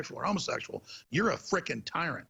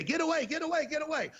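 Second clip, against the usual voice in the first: high, repeated mock shooing; mimicked panic; 12 semitones higher than usual. Hecklers refusing to listen to host, mock-frantic. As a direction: squeal it as an imitated heckler. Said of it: "They begin cussing and then say".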